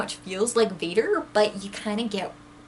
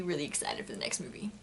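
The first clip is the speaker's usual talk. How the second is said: whisper; 6 semitones lower, 10 dB quieter, faster than usual. Real, not acted. Excited aside trailing off.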